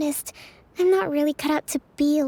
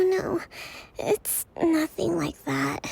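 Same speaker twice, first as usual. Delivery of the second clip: normal talking voice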